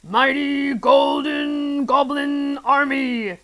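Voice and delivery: slow, grand villain proclamation; rally the troops, then flub it; crying out. A narrator's reading. Mister Gum summoning his goblins, grand cry. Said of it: "he cried".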